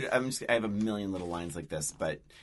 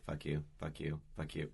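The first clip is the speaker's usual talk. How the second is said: low, hushed muttered curse; little whispers; 5 semitones lower, 9 dB quieter than usual. Retold or imitated. Retelling his little whispers as she gets ready, hushed. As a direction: slip it in softly under the breath. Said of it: "the little whispers of".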